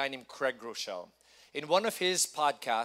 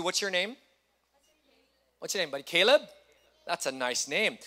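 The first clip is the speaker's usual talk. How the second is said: usual speaking voice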